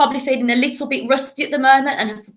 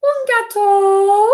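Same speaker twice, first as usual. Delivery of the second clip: very high and slow, drawn out; silly cat voice, slowly; 10 semitones higher, 4 dB louder, much slower than usual. Shown as a demonstration. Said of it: "the silly voices that we do".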